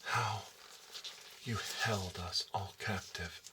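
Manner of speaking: ordinary talk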